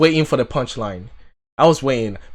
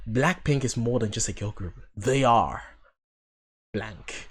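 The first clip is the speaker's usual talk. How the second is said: movie trailer voice; 6 dB quieter than usual. Pretend. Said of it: "that sounded like a trailer".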